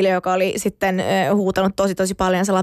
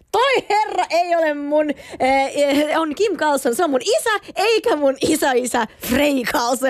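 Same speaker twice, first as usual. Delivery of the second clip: mock-angry correction, higher and slow; young fan scolding the crowd about her dad, indignant and higher; indignant protest; 6 semitones higher, slower than usual. Girl correcting fans about her father's name, slow, higher and indignant. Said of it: "Sit mä suutuin niin paljon".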